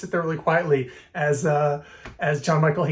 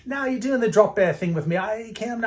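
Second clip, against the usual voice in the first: higher, put-on Australian accent; bad Australian accent; 7 semitones higher than usual. Australian suspecting a drop bear prank, mock Australian accent. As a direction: scoff in a rough Australian imitation. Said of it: "that's my bad Australian accent".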